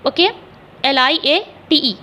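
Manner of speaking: letters spelled one by one, slowly; spelling out letters. Shown as a demonstration. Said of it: "ठीक है, retaliate". (धीरे धीरे अक्षर बोलकर स्पेलिंग)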